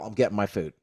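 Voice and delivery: usual speaking voice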